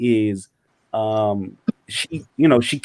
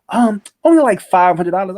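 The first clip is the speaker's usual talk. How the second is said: higher, lightly voiced girlfriend asking for money; mimicking girlfriend; 7 semitones higher, 5 dB louder than usual. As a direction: imitate her breezy request, pitched up. Girlfriend voice continues.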